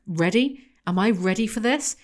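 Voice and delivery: normal talking voice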